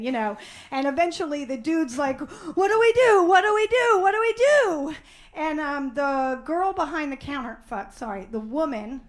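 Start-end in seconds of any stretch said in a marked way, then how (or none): 2.2-4.9 high, loud, frantic repeating; mock panic. Dude facing melting ice cream, mock panic. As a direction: cry out louder in hammed-up panic. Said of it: "a real panic sets in".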